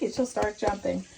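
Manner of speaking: ordinary delivery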